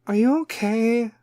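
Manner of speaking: high, slow mock-concerned voice; Kim K. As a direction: fake celebrity concern, high and dragged out. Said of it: "is going to be all Kim K".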